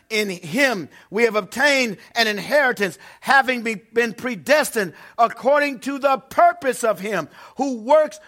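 loud, slow, solemn reading of scripture. Acted. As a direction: proclaim the verses to the congregation. Reads scripture at full force.

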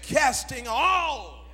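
"all" stretched long and swelling; stress on "all". Real, not acted. Preacher quoting Peter to the congregation, long emphatic "all". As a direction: draw out "all" slowly for emphasis. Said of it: "I have to emphasize the word all".

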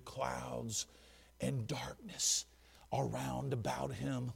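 hushed, slow, partly whispered; scary reading. Shown as a demonstration. Preacher reads verse two in a scary hushed voice. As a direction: read it low and ominous. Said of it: "see this in a scary way".

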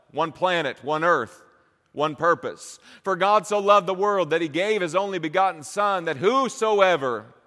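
loud, high, driving sermon climax; preaching at full force. Acted. Preacher urging the congregation, at full force. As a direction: preach it out with rising fire.

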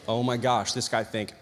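low, hushed realization; retold dawning horror. Student realizing what the old man thinks, low and hushed. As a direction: sink into a quiet, low disbelief.

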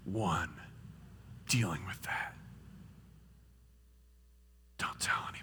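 low, hushed, isolating; secretive devil whisper. Acted. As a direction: sink into a conspiratorial whisper. Devil's isolating lie turns secretive.

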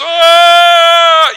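put-on long, very high, loud child's scream; mock tantrum scream. Child screaming at bedtime, long high shout. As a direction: howl like a kid in a fit.

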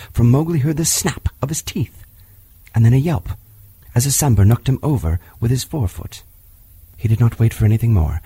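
ordinary delivery

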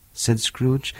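plain reading voice

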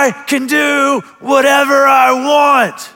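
a small daughter defying her father, shouted; defiant shout. Retold or imitated. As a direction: shout it back like a headstrong little girl. Defiant daughter talks back.

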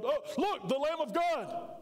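sudden high call, slowed on the Lamb of God; yelled. Shown as a demonstration. Preacher yelling to the congregation as a demo. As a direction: call it out to the crowd. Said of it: "Could you imagine if I yelled".

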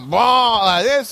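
slow, drawn-out boom; tumbling boom. Retold or imitated. Showing how the wave tumbled him, slow boom. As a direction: act out being thrown around.

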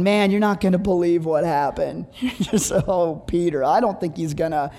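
low, halting voice; hesitant bad-news call. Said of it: "And I'm going".

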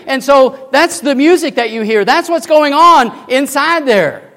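full-force preaching. Acted.

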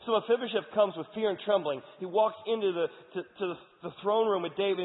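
high, loud preaching retelling the story; fired-up storytelling. Acted. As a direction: build the drama like a pulpit storyteller. Preacher narrates story at full force.

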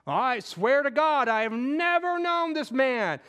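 insistent sworn denial, drawn out; vow to God. Acted. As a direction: swear it solemnly and loudly. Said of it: "he takes a vow".

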